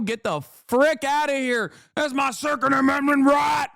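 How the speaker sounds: high, hammed-up indignant yelling, climbing to a shout; mock gun-nut outrage. Streamer mocking gun fans over the memorial gun ban, hammed-up outrage. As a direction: play an offended gun fan losing it.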